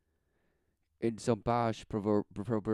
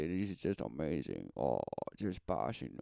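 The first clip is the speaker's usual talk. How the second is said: much lower and softer; sardonic; 8 semitones lower, 7 dB quieter than usual. Real, not acted. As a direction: drop into a dry aside. Sardonic aside dropping low.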